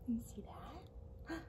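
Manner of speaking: hushed secret whisper. Acted. Librarian showing kids a picture, whispering softly.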